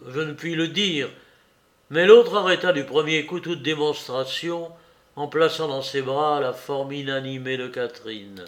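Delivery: plain reading voice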